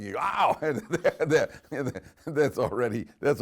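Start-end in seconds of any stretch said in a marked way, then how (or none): 0.2-0.6 loud, high yelp of pain; sudden cry after bumping the head; acted-out "ow" of pain. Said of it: "if you bump your head".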